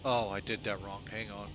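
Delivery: ordinary talk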